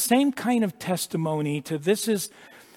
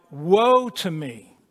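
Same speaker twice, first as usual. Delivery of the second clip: crying out; 3 dB louder than usual. Retold or imitated. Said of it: "He cries out".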